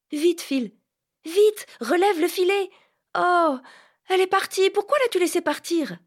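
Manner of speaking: put-on frantic girl, prize slipping away; high, dismayed shout; excited young Vanka.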